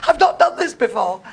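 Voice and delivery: high, loud mock confession; a loud put-on confession; mother owning up. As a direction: blurt it out like the mother in labour.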